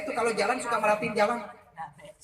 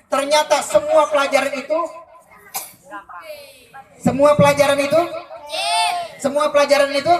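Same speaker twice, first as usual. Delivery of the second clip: building each repeat louder; rising shout; 6 dB louder than usual. Acted. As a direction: rouse the crowd with each repeat. Crowd-rousing repeated build-up. (makin keras tiap ulangan)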